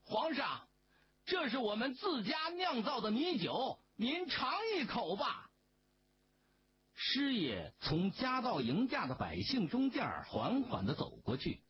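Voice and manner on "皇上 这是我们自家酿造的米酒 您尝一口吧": high, sprightly offering call; a villager calling out grandly to the emperor; showy call. A narrator's reading. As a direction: play it up with a flourish. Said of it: "做出了个潇洒的样子，喊道".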